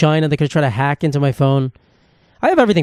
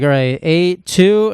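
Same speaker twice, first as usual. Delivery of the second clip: slow repeat; 2 semitones higher, much slower than usual. Retold or imitated. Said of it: "And I was like".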